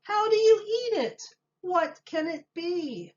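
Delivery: a high, wondering voice; high townsfolk voices. A narrator's reading. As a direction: ask it like a crowd of curious townsfolk. Townspeople puzzled chorus.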